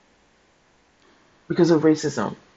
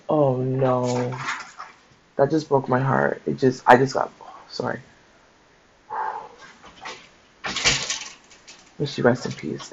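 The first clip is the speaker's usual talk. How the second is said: breaking down, voice dropping soft; fighting back real tears; barely holding it together; heartbroken; 6 dB quieter than usual. Real, not acted. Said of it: "that just made me so emotional".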